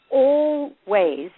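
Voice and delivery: "always" drawn out very slowly, syllable by syllable; emphatic ALWAYS. Real, not acted. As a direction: stretch "always" right out for emphasis. Hammers home her point.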